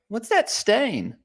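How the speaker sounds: put-on high, slow wondering kid voice; curious child. Church kid asking about the microphone, high puzzled child voice.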